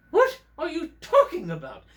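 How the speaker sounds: put-on high, loud puppet voice, English accent. English puppet challenges host.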